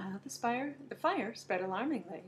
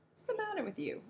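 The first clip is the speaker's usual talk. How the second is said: puzzled; 11 semitones higher than usual. Acted. Kookaburra puzzled by Gumble.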